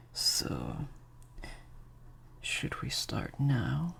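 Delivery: usual speaking voice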